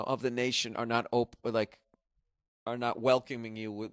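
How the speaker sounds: ordinary talk, nothing marked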